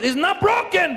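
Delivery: put-on high, shouted Mexican-accent verdict; Mexican referee. Mexican referee insisting to injured player, high shouted Mexican accent. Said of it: "the first person over was the Mexican referee".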